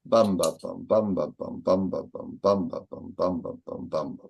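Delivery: chanted metre, first "bum" of each three heavy, two light beats after; dactylic beat. Shown as a demonstration. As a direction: beat out "Bum, ba, bum" long-short-short in steady repeats. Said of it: "a dactylic line is long, short, short".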